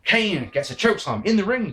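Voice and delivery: ordinary delivery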